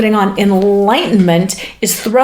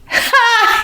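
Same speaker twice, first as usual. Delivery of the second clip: short high-pitched burst of laughter; real, sudden laugh at a joke; amused laugh; 14 semitones higher than usual. Real, not acted. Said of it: "the reason I was laughing".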